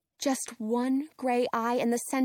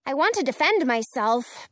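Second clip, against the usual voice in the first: ordinary delivery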